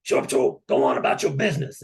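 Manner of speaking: lightly hammed-up gruff order, quick and forceful; mock gruff officer. Officer sending the sailor off about his business, imitated gruff order. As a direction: imitate a brusque brush-off.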